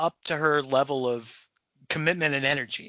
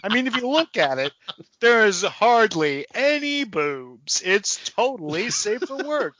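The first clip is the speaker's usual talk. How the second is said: indignant self-justification. Acted. Office worker defending himself to coworkers, mock-indignant. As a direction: plead your case with exaggerated indignation.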